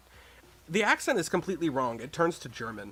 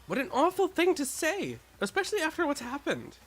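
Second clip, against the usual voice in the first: put-on higher, louder, reproachful girl; a lightly voiced shocked young woman; indignant girl voice; 14 semitones higher, 4 dB louder than usual.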